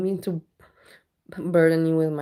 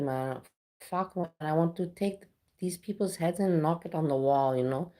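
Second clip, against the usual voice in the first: normal talking voice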